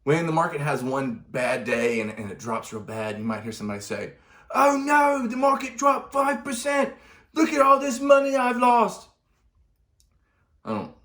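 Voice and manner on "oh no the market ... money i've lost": much higher, louder, wailing put-on voice; panicky investor accent. A caricatured investor wails to the viewer about losing money, in a high, loud put-on accent. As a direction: squeal it like a caricature of a frightened investor. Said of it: "I don't know why I did the accent".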